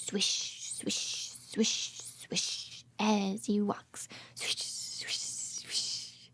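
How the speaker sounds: hushed rhythmic storybook whisper. Acted. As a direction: whisper each step and swish. Acting out the elephant walking.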